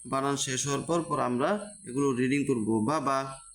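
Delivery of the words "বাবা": বাবা said short, without dragging either vowel; short quick vowels. Shown as a demonstration. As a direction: keep both syllables of বাবা brief. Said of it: "আমি উচ্চারণগুলো তাড়াতাড়ি করেছি".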